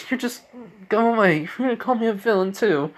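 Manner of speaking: high-pitched imitation of a crying girl; tearful. Crying girl to a stranger, high tearful protest. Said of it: "she's crying".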